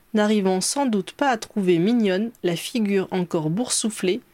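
usual speaking voice

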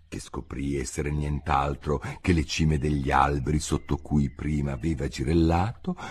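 ordinary delivery, nothing marked

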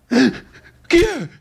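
sudden high, alarmed question; startled. Acted. Man startled by someone entering, high alarmed call. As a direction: jump at the intruder.

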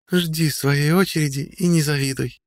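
put-on high, slow languid young woman; languid girl. A narrator's reading. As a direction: drawl it out languidly. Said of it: "томно ответила ей Одри".

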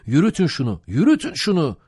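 loud, high rallying cry; forceful boatman's exhortation; urgent urging. A narrator's reading. Mate urging rowers on.

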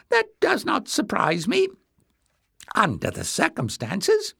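high-pitched, insinuating remark; sly murmur. A narrator's reading. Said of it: "he murmured".

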